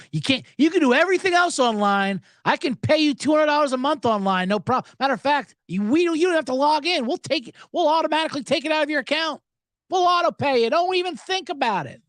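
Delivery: high, eager company voice; a sugary sales pitch, mocking; mock cable company. Comic mocking the cable company's pitch, high and eager.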